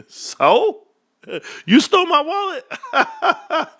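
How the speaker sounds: high, drawn-out laughing taunt; mocking laughter. To a dude who stole his empty wallet, laughing mockery.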